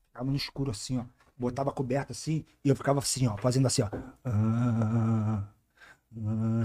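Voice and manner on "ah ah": mock groaning.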